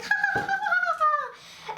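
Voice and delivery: very high mock cackle; imitated hilarious laughing. Date laughs at being called names, shrieky imitated laughter. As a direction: imitate someone finding it hilarious. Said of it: "that's hilarious".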